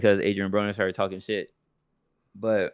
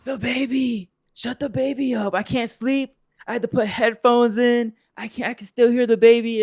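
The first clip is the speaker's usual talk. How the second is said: high, whining mock complaint, louder at the end; whiny grown man; 13 semitones higher, 4 dB louder than usual. Mocking the gray-haired man yelling on the flight, high whiny voice. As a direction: whine it like a spoiled man on a plane. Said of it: "grown ass man screaming on a plane talking about".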